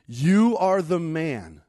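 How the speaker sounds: forceful accusation. Acted. Prophet confronting David with his sin, forceful accusation. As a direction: drive the accusation home word by word.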